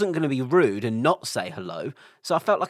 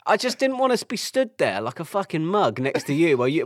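ordinary delivery

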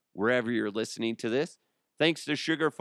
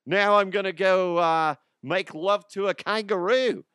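high-pitched, hammed-up Australian accent; 9 semitones higher, 5 dB louder than usual. Host improvising a thank-you to Australian listeners, mock Australian accent. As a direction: put on a loud, silly Aussie voice. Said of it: "I didn't do Australian".

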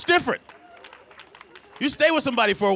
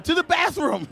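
laughing answer; 9 semitones higher than usual. Retold or imitated.

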